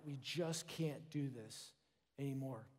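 a hushed, worn-out cry for help; desperate plea. Retold or imitated. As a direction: beg God quietly, nearly spent. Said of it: "God's people were crying out".

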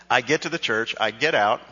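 ordinary talk, nothing marked

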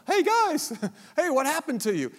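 a carefree shout to the brothers above, with a laugh; lightly voiced cheerful boy in a shallow pit; cheery Joseph. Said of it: "he's looking over the top going".

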